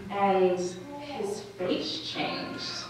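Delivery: ordinary talk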